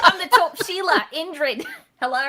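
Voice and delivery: high, loud, hammed-up Australian accent with a laugh. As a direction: go big and silly with an Aussie voice. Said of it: "Who's this new Australian guest?".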